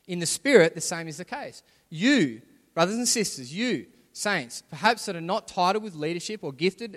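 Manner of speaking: rally the saints to faith; preaching with force. Acted. Preaches to the church.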